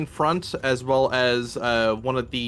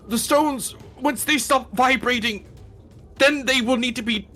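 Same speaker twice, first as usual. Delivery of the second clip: high, otherworldly telepathic voice; squid creature voice; 10 semitones higher, 3 dB louder than usual. Acted. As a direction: speak as a strange squid mind. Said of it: "a muffled voice coming from that squid creature".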